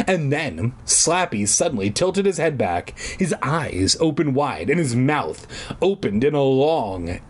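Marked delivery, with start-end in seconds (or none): none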